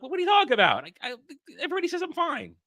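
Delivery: high-pitched, baffled comeback; exasperated protest. Retold or imitated. Streamer argues with YouTube's warning, exasperated. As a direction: protest in a rising, incredulous voice.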